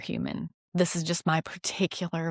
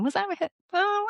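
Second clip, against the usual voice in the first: high unsure. Retold or imitated. Doubting inner voice, retold.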